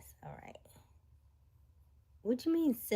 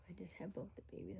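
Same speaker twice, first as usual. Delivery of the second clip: quick soft whisper; 17 dB quieter, faster than usual. Real, not acted. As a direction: whisper it fast. Reads sister's message whispered.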